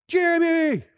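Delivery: angry coach shouting at his player; coach yelling. Retold or imitated. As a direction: yell the name across the field. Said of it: "barking and yelling when you made a mistake".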